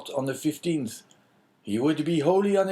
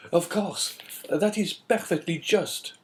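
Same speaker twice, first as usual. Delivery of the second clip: higher, slow eager agreement; eager cry; 6 semitones higher, slower than usual. A narrator's reading. Inspector to the Englishman, eagerly agreeing. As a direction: jump at the offer, pitched up. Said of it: "cried Monsieur de Beauville".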